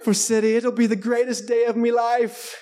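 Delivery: high, emotional British fan drifting toward Irish; bad British accent. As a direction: gush in a clumsy British accent. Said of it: "I'm gonna do my British accent".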